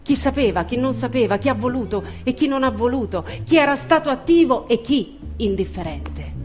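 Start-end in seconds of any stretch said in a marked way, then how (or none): none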